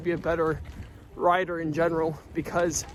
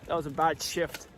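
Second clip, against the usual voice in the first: ordinary talk, nothing marked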